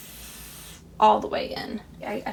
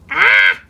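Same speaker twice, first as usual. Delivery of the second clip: startled shriek; 13 semitones higher, 12 dB louder than usual. Real, not acted. Startled mid balloon craft.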